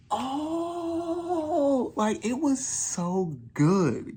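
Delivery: very high, slow, gushing delight. Real, not acted. Fan gushing to viewers about the episode, very high and drawn out. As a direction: savour it like the best thing ever.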